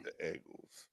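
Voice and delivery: "Eagles" echoed very softly as "Eggles"; hushed teasing echo of "Eagles" as "Eggles"; mimicked "Eagles". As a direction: quietly copy "Eagles" with a short e, like Eggo. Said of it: "You made me think of Eggo waffles when you say that".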